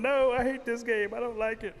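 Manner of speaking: high, loud complaint; loud whiny objection; protesting turtle. Acted. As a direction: wail in protest from inside a shell. Turtle protests from inside shell.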